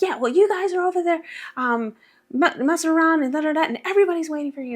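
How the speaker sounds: high-pitched, hurried mock complaint; mocking imitation. Relatives on the phone scold her for messing around, mockingly imitated.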